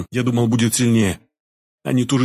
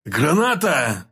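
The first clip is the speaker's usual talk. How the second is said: yelled warning; 5 semitones higher, much slower than usual. A narrator's reading. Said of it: "заорал Башня".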